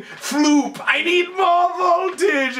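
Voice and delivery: high, drawn-out demand to an assistant; mock scream. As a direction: imitate a scientist screaming over noise. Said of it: "he's screaming, it's really loud".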